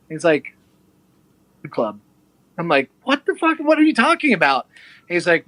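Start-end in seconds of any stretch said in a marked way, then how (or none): 1.6-2.0 lower, softer, dead-dry reply; Brian's deadpan. Retold or imitated. Brian answering the baffled narrator, low and quiet deadpan. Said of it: "He's got a really dry humor". 3.0-4.7 much higher, incredulous outburst; shocked disbelief. Retold or imitated. Reacting to the Norway invitation from a stranger, pitched way up in shock.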